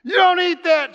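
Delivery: high, loud shout of stop; a stranger's sharp shout of stop; retold shout. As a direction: yell it at a stranger about to eat. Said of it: "and said".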